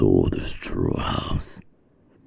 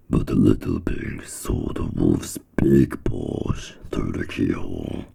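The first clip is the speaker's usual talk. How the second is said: ordinary delivery, nothing marked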